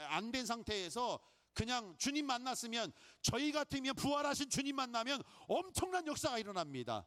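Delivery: ordinary delivery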